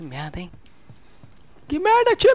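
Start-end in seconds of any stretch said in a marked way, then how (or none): 1.7-2.3 high, loud yell at the team; frustrated shout. Real, not acted. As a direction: shout it out in annoyance. Yelling at teammates in game.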